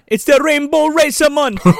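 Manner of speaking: high, put-on Jamaican exclamation; mock Jamaican accent. Naming the go-kart after Cool Runnings, mock Jamaican accent. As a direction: cheer it in a hammy Jamaican accent.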